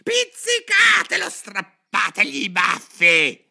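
frenzied, very high screamed orders; shrieking. A narrator's reading. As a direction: shriek each command, stretching it out. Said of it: "strillò la regina". (ordini strillati a squarciagola)

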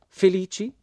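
high, loud, rising exclamation; astonished. A narrator's reading. American woman astonished by the narrator's claim, incredulous. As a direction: echo the word in disbelief. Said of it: "esclamò missus Flett guardandomi con occhi stupiti".

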